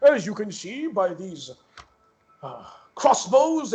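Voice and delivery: high, slow, cheery NPC greeting; George Bigman. Acted. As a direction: stretch it out in a high, beaming stranger voice. GM voicing the blacksmith NPC.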